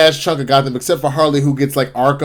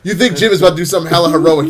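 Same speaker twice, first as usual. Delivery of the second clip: usual speaking voice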